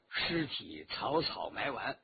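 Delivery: ordinary delivery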